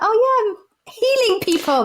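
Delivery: high, slow dreamy naive voice; naive former self. Retold or imitated. Co-host mocking their younger self, naive. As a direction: draw it out like a starry-eyed beginner. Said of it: "I'm like".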